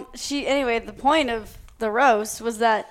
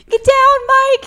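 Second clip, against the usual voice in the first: mock yell, much higher and louder; guide's yell; 13 semitones higher, 11 dB louder, slower than usual. Guide yelling to Mike from afar, mock shout. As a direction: shout it across the swamp like the guide. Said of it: "you hear the guide Hoppy go".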